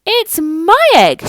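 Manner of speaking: high, loud, slow angry cry; an indignant bird claiming the egg; sputtered. Acted. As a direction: sputter with outrage. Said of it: "she sputtered".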